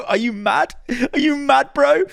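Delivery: high-pitched, giggly mock taunt; cracking up while teasing someone; laughing taunt. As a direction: tease through helpless high laughter.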